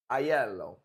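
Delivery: Aiello said as eye-ELL-oh, the Italian way; Italian pronunciation. Shown as a demonstration.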